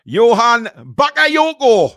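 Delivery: big, drawn-out Geordie announcement; loud, exaggerated Geordie pronunciation; Geordie flavour. Shown as a demonstration. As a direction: belt out the name in Geordie, high and slow. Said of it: "give it a bit of Geordie flavour".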